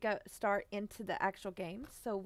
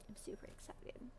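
very soft, hushed whisper; barely audible, secretive aside; excited whisper; 18 dB quieter than usual. Real, not acted. Excitement whispered after loud cheer.

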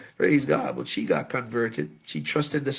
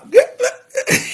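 high, loud chuckle; quick burst of high laughter; short laugh; 9 dB louder than usual. Real, not acted. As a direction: laugh briefly at your own joke. Laughing at own repeated joke.

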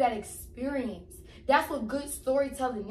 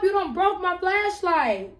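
normal talking voice

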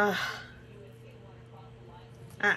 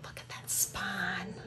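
soft, awed whisper; 5 dB quieter than usual. Real, not acted. Admiring the book spine, whispering in awe. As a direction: hush it like a secret treasure.

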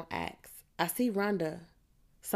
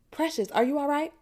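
much higher, worried question; alarmed concern; 9 semitones higher, 4 dB louder than usual. A narrator's reading. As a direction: check urgently on a student in distress. Teacher alarmed at student in distress.

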